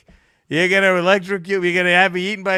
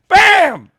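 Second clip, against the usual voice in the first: loud high exclamation; triumphant bam; 13 semitones higher, 10 dB louder than usual. Real, not acted. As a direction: slam it down like a win. Gloating exclamation.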